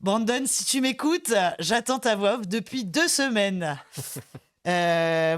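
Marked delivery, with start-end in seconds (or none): 0.0-3.8 mock-reproachful call-out, higher and drawn out; mock plea. Presenter teasing her late voice actor friend, mock reproach. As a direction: call out the latecomer slowly, in playful complaint. Playful call-out to absent colleague.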